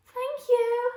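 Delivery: a bright grateful cry; grateful fox. A narrator's reading. Fox thanking George for the sock, high and loud. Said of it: "said the fox".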